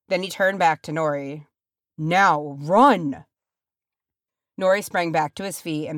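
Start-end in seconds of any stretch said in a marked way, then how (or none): 2.0-3.3 loud, forceful order; urgent command. A narrator's reading. Dwarf leader sending his companion off, urgent. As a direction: push hard on the final word.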